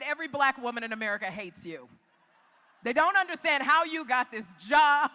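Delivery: building from brisk talk to a loud shout; retold shout. Retold critics' words, ends shouting.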